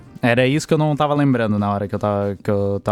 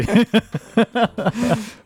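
sudden high laugh; bright laughing burst; high burst of laughter; 10 semitones higher than usual. Real, not acted. Laughing at [name] joke.

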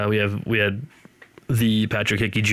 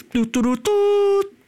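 high-pitched sung doot fanfare; mouth-made sound effect, far above speaking pitch; imitated sound effect; 4 dB louder, slower than usual. Shown as a demonstration. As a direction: toot out a little jingle, last note held. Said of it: "he'd go like something like this".

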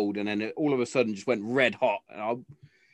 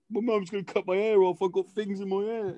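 high-pitched mimicry of a sulking little girl; mock a daughter's stroppy complaint; put-on little kid voice; child in a strop; 8 semitones higher than usual. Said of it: "'Cause she was in a strop".

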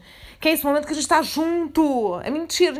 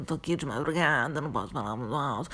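much softer and lower, hushed late-night voice; speaking softly; 8 semitones lower, 7 dB quieter than usual. Shown as a demonstration. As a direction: keep it down, others are asleep. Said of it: "eu fico falando baixinho". (voz baixinha de madrugada, para não acordar ninguém)

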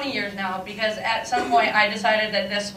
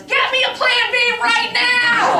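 urgent high shout, slowed down; panicked demand; 10 semitones higher, 4 dB louder than usual. Retold or imitated. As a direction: bark the order in a panic. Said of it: "But what I said was".

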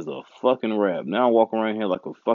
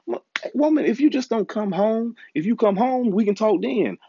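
mock exasperated retort; 12 semitones higher than usual. Retelling how he answered his woman on the phone, higher, exasperated. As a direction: snap back at her like the call is a nuisance.

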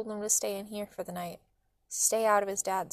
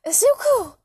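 high, loud cry of alarm; urgent high-pitched shout; yelling; 10 semitones higher, 10 dB louder than usual. A narrator's reading. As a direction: yell the child's name in alarm. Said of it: "Mom yelled".

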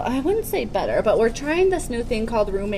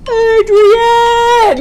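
loud high Rocky yell; Rocky impression; 12 semitones higher, 9 dB louder than usual. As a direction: bellow her name like the boxer.

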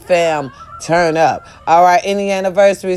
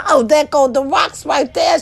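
excited; 8 semitones higher than usual. Retold or imitated. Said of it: "I said".

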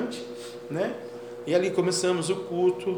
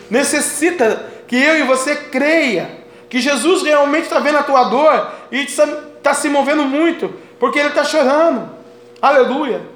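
raised, high-pitched sermon cadence; full-force preaching; 7 semitones higher, 8 dB louder than usual. Real, not acted. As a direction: proclaim it fervently to the congregation. Prega com força total. (pregação fervorosa com voz elevada)

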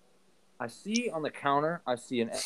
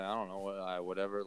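soft, lower mumble; mumbled reply; 4 semitones lower, 11 dB quieter than usual. Retold or imitated. Son retells his shrugging answer to dad, softly mumbled. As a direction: shrug it off under your breath. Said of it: "I'm like".

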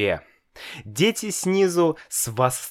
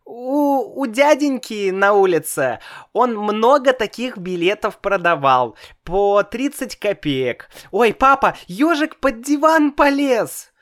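put-on high little boy's voice, much higher; child voice; 11 semitones higher, 4 dB louder than usual. A narrator's reading. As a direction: play a small boy, innocent. Child chatters to father.

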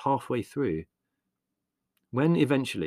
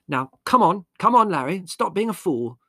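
high, loud, impatient scolding; brisk snap; 10 semitones higher, 9 dB louder than usual. A narrator's reading. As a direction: order him sharply to behave. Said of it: "she snapped briskly".

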